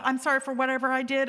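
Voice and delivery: quick, tossed-off mock apology; insincere apology. Imitating someone's blanket apology, insincere. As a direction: rattle off a non-apology. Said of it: "Those are not apologies".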